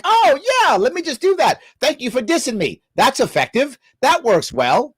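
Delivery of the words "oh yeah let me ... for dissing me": higher, sarcastic sing-song agreement; mock eager friend. Mocking the host's advice with a higher gushing friend voice. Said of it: "who would be like".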